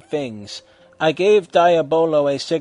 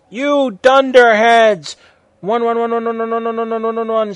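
screaming; 8 semitones higher, 7 dB louder than usual. A narrator's reading. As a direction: scream after them as they vanish. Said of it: "screamed Dumbledore".

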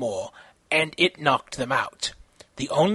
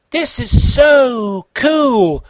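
high, loud, slow squeal; excited squeal; 8 semitones higher, 7 dB louder, much slower than usual. Acted. Konata in Kenshiro's house, high excited squeal. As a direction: squeal it with delight. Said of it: "Konata squealed".

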